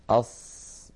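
sustained emphatic s on "أص"; saad articulation. Shown as a demonstration. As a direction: place the tongue as for seen and hold the s of "أص". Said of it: "تماما كالسين".

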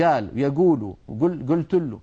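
"قال، يقول" said "gaal, yigool"; every ق turned to a hard g; Gulf colloquial qaf. Retold or imitated. Teacher quoting Peninsula dialect speakers, g for qaf. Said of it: "يقولون".

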